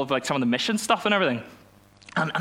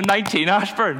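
repeating the address through laughter, higher; laughing repeat; 6 semitones higher, 5 dB louder than usual. Shown as a demonstration. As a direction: say it again, still laughing. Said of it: "and I gotta repeat it".

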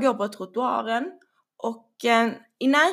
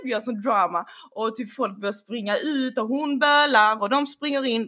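usual speaking voice

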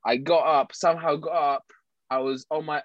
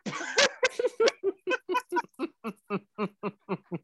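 laughing; 4 dB quieter than usual. Real, not acted. Laughing at friend's cry.

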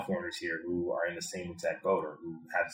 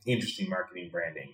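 normal talking voice

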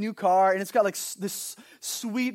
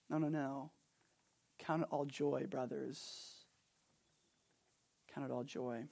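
much softer, lower, tender rebuke; soft correction; 6 semitones lower, 16 dB quieter than usual. Real, not acted. Pastor answering the complainer with scripture, soft and tender. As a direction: gently turn them back to joy.

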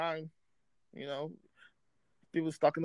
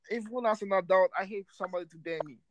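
ordinary delivery

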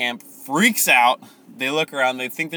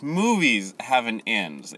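ordinary talk, nothing marked

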